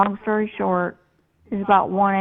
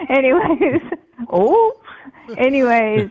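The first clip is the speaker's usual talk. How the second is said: laughing; 5 semitones higher, 5 dB louder than usual. Real, not acted. Laughing off a slip.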